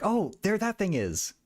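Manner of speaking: mild mock yell. Streamer retelling an excited reaction to the show, mild mock yell. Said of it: "yelling at, like".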